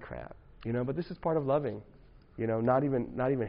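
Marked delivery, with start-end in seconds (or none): 0.0-0.4 muttered dismay. Retold or imitated. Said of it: "And I'm like".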